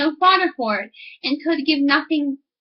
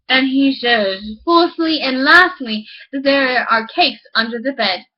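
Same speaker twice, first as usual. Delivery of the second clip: slow, louder build to lastly; measured, loud proclamation; solemn announcement; 3 dB louder, slower than usual. Acted. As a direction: announce it like a grand finale. Farmer announcing slowly.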